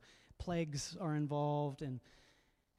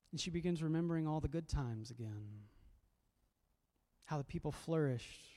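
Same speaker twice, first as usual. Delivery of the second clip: normal talking voice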